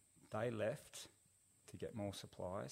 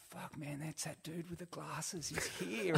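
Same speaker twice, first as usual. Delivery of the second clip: amazed recognition; 8 semitones higher than usual. Retold or imitated. Surfers spotting the stranger on a boat, acted amazement. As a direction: act out the boys' astonishment, building at the end.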